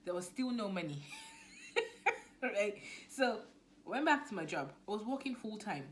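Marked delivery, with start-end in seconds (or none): 1.1-2.4 high breathy burst of laughter. Real, not acted. Vlogger laughing about earning nothing, high laugh. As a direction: laugh at the irony.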